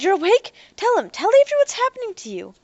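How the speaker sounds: put-on high, loud and fast, a frantic young woman; urgent plea. A narrator's reading. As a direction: Ariel urges Derek to speak up, high, loud and fast. Ariel urgent amid fight.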